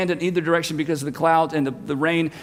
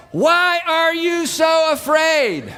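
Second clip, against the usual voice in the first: slow, high, loud yell; loud question; 9 semitones higher, much slower than usual. Retold or imitated. Jesus yelling his question over the wind, loud. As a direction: bellow the question over the storm. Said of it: "He's having to yell".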